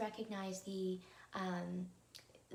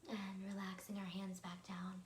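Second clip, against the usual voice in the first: guided meditation; 3 dB quieter than usual. Acted. Host leading listeners through evening breathing, calm guided meditation.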